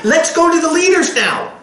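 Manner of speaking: high, eager rush; excited believers. Retold or imitated. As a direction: bubble over with good news. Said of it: "they're gonna be so excited".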